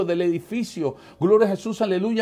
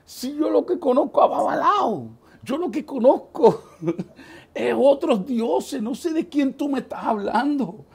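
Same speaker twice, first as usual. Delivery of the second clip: mocking disbelief; 7 semitones higher, 3 dB louder than usual. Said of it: "Y yo en mi mente".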